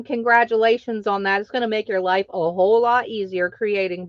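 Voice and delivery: normal talking voice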